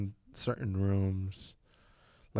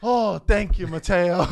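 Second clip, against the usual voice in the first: high, loud thanks with laughter; triumphant mock gratitude; gleeful sister; 6 semitones higher, 8 dB louder, slower than usual. Sister thanking brother who let it slip, loudly gleeful.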